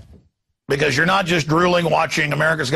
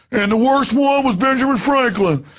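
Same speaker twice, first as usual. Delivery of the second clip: high, put-on country boy accent; country boy twang, pitched way up; 8 semitones higher than usual. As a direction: imitate a police lecturer with a hammed-up country boy accent. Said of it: "I'm doing a country boy accent".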